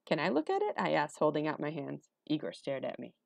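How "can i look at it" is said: put-on high-pitched boy asking; eager request. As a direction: ask hopefully in a high voice. Boy politely asks to hold bear.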